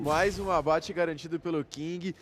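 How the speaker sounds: normal talking voice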